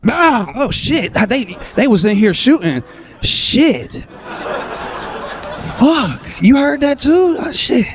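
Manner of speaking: high, mock-panicked whisper of alarm; mock startled panic. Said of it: "I'm like".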